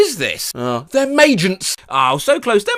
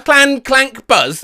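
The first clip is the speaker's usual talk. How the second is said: slow clanking noise; 8 semitones higher, 5 dB louder, much slower than usual. Shown as a demonstration. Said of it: "Do with accent and crescendo".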